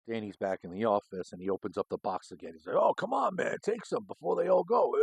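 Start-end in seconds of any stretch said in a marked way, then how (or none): 2.8-4.9 mock Danny. Danny pushing cigars on cops.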